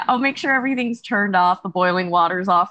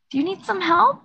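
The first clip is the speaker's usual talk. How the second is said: high gentle offer; 8 semitones higher than usual. Acted. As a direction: offer help sweetly, pitched up. Player voices gentle offer.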